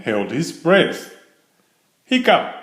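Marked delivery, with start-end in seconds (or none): 2.0-2.6 hiccup. Acted. Franklin's hiccups.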